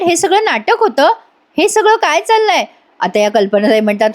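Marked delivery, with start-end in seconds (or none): 0.0-2.6 much higher, baffled question; retold disbelief pitched up high; astonished. Asmita asking her parents about the drama, astonished. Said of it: "अस्मिता यांना बघून आश्चर्यचकित होते".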